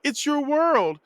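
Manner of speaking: high-pitched call at ordinary volume; imitated yell. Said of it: "she yells out".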